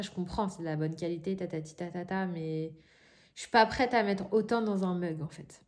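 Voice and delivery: normal talking voice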